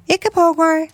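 very high little eaglet voice; hungry chick. A narrator's reading. Newly hatched eaglet calling for food, high chick voice. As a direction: pipe up like a hungry baby bird.

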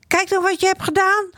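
put-on high, shrill-pitched angry outburst of a child; furious kid shouting at his friend; boy shouting. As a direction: yell at your friend for ruining it. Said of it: "staat Joran te schreeuwen".

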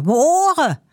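a high, pained complaint; doctor crying out. A narrator's reading. Doctor complaining after the loud hello, pained and high. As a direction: clutch your ears and wail it. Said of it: "roept de dokter".

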